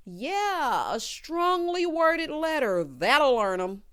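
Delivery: drawn-out, high sarcastic endorsement; mock approval. Host mocking a friend's angry parking note, sarcastic. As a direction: stretch it out loud, mocking the plan.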